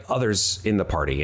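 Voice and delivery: ordinary talk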